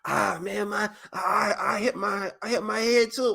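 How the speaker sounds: high, whimpering fake hurt; a mock whimper to fake pain; fake crying. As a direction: pretend to be hurt. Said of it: "pretending that you hurt too".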